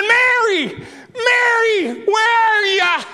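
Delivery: high, far-carrying shout; loud calling out. Shown as a demonstration. Preacher showing how the Master called Mary, loud holler. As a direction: holler the name as if searching. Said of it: "He said".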